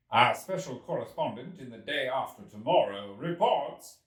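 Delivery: loud mock news report; a brash young journalist shouting. A narrator's reading. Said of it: "or rather shouting".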